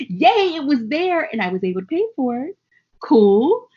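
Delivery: gleeful yay at paying a bill; excited cheer. Retold or imitated. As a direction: cheer like the bill paid itself. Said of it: "Or is it like".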